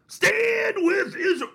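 very high, drawn-out shouted slogan; mock pastor's cry. Host mimicking a Baptist pastor's first words, hammed-up shout. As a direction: wail it slowly, stretching STAND and ISRAEL.

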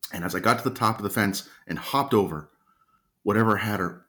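usual speaking voice

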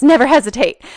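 a hint of mom, loud and sure; mom's motto. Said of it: "she says".

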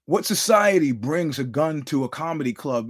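ordinary talk

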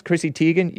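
"Teigen" as TEE-gen; long ee in "Teigen"; common pronunciation. Shown as a demonstration. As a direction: start "Teigen" like tea. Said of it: "pronounce it Teigen or whatever".

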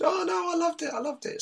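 high, giddy imitation of a cheerful loser; gushing mock fighter. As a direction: squeal it like you loved losing. Mimicking fighter gushing after loss.

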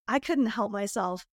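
normal talking voice

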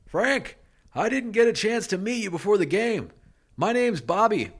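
put-on a shy boy calling across the gym; high boyish voice. Bobby voiced in story.

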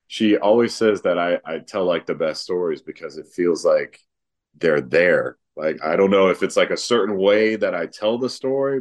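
normal talking voice

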